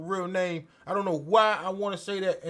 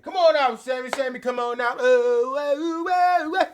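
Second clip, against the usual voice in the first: calling Sami out; 5 semitones higher, 6 dB louder than usual. Retold or imitated.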